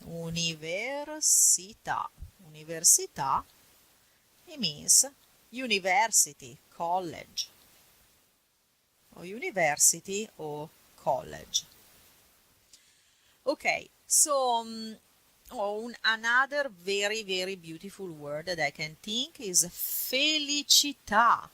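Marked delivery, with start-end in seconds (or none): 0.1-2.1 UNIVERSITÀ stretched syllable by syllable; slow teaching demonstration of "Università"; slow model pronunciation. Shown as a demonstration. Teacher models the word slowly. 19.7-21.5 FELICITÀ drawn out, high and loud; slow, emphatic demonstration of "felicità"; savoured model word. Shown as a demonstration. Presents a very beautiful word.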